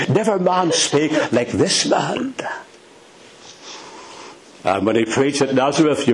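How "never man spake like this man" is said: higher-pitched astonishment, rising at the end; amazed, pitch climbing in disbelief. Acted. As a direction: say it as if flabbergasted. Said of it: "They were flabbergasted. They were amazed".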